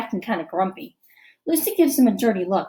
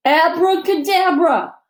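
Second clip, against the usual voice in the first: higher, slow, louder hammy magic word; hammed-up magician; 7 semitones higher, 5 dB louder than usual. A narrator's reading. Boy showing off to the class, hammy magician. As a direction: overact it like a stage magician. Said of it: "His overacting is even worse".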